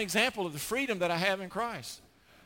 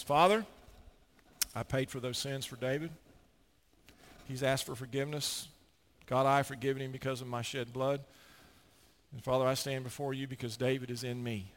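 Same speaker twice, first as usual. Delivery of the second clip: calm advocate; 6 semitones lower than usual. Retold or imitated. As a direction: gently defend him before the Father. Jesus defending David.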